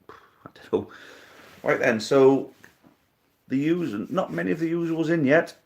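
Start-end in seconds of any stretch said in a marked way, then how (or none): 0.1-0.9 breathy whispered aside; a quick whispered shrug. Real, not acted. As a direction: drop to a whisper, half to himself. Muttered aside to himself.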